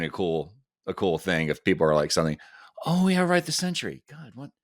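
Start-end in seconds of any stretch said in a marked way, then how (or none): 2.9-4.5 high, excited gasp of remembering; sudden recognition. Pretend. Fans suddenly remembering the Sentry, high excited surprise. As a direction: squeal it like it all just came back.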